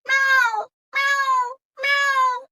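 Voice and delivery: high, loud cat meows; cat meowing. A narrator's reading. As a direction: cry like a hungry cat. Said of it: "But Greedy Cat cried".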